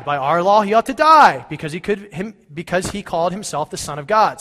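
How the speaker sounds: loud, pressing their demand; angry priests raising their voices; insistent leaders. Acted. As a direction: hammer the law at Pilate.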